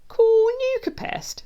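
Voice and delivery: high, loud, slow jeer; mocking echo. A narrator's reading. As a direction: throw it back slowly in ridicule. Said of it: "laughs Maximus".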